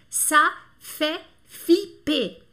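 teacher spelling out ça fait flipper by sound; syllable by syllable. Shown as a demonstration. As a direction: dictate "ça fait flipper" with a pause after every syllable. Teacher dictates the French expression.

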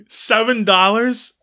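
high, fast disbelieving rant; high incredulous voice. Retold or imitated. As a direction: squeak it in disbelief. Quoting the robbed character.

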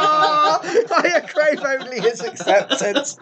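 high, slow, laughing melodrama; mock yearning. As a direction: ham up the pining, slow and high. Mock longing about teammate.

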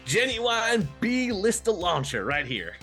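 high, stretched-out hawker's call; showy arms dealer. Acted. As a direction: unveil it with a slow, high-pitched flourish. Arms dealer unveiling the launcher.

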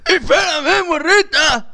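high, laughing catcall; leery old man calling. Acted. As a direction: chase her with a creepy call. Old man chasing girl.

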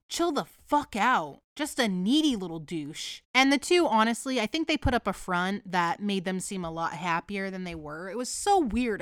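ordinary talk

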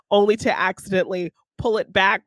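normal talking voice

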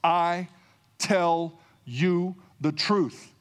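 "I tell you the truth" drawn out very slowly, each word weighted; slow it right down and lean on every word of "I tell you the truth"; solemn emphatic slow delivery. Shown as a demonstration. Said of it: "It's emphatic".

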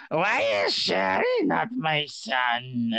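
slow, high mom voice; mom impression. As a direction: play his mom, drawn out. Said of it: "This is my mom".

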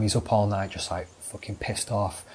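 ordinary talk

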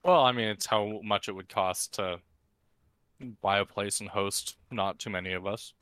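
normal talking voice

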